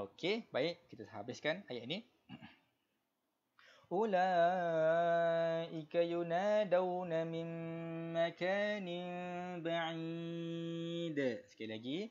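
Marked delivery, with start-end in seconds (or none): 3.9-11.2 Quran recitation. Shown as a demonstration. Teacher reciting verse for the class, slow melodic tajwid. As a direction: recite for students to follow.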